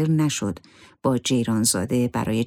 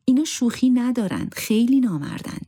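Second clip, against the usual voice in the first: higher-pitched, insistent plea; emphatic urging; 9 semitones higher than usual. A narrator's reading. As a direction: urge him firmly, voice lifted well up. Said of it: "زهره با تأکید گفت". (با تأکید و اصرار)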